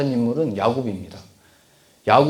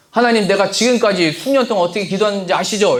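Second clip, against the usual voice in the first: higher and louder, arguing with God; the wrong way to pray, a complaining demand; demanding prayer; 7 semitones higher, 5 dB louder than usual. Shown as a demonstration. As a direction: press God as if owed something. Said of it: "이렇게 따지면 안 되는 겁니다".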